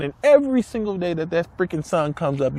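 usual speaking voice

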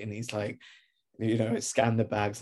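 normal talking voice

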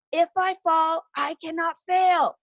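half-voiced yell at a high pitch; retold yelling. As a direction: imitate a family chanting a rallying cry. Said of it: "we're all yelling".

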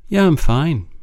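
a bright, brushing-off "Yeah, I'm fine"; breezy mask. Shown as a demonstration. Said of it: "is a bit of a mask".

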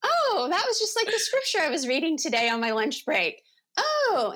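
high, sing-out "oh" of innocent realization; high-pitched mock-innocent musing; sweet wonder. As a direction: play up the sweet, naive surprise. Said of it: "you just take it so sweetly".

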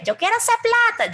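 high mocking voice for the money-grabber; mock greedy. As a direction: sneer it out like a cartoon profiteer. Mocks a greedy business motive. (tono burlón y agudo de codicioso)

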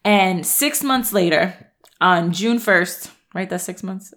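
ordinary talk

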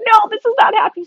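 high, fast freak-out; rushed, high-pitched imitation of alarm; mock panicked protest. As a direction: refuse in a hammed-up panic. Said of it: "He's, like, yelling".

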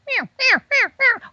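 high repeated cow elk mews. Retold or imitated. Hunter imitating the cows answering the bull, high mews. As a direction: call like a herd of cows running uphill. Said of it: "you could hear all the cows".

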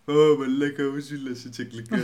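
loud mock outrage at someone's English; mocking bystander. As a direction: jeer like a scandalized local. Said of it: "το έχουμε ότι". (κοροϊδευτικά, με ψεύτικη αγανάκτηση)

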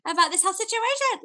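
high, strained angry rant; angry outburst. Retold or imitated. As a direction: blurt it out in a rage. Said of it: "just going, like".

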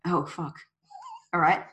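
normal talking voice

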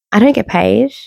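normal talking voice

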